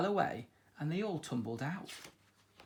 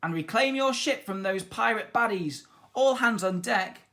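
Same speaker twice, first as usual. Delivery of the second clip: cried orders; 6 semitones higher, 5 dB louder than usual. A narrator's reading. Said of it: "cried Captain Flynn".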